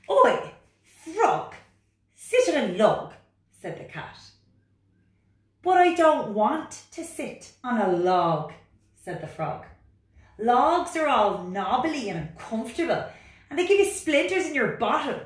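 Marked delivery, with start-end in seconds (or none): 0.1-3.1 loud, high, bossy cat voice. Acted. Cat character in picture book. 5.6-8.5 high, loud, protesting frog voice; reluctant frog. Acted. Frog character in picture book. 10.4-15.2 high, loud, protesting frog voice; reluctant frog. Acted. Frog character complains.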